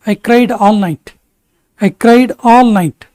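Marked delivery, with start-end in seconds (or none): 1.8-3.0 very slow, words drawn apart; slow, clear repetition. Shown as a demonstration. Teacher repeating for students, slow and deliberate. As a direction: spell it out slowly for the class. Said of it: "teacher models sentence twice".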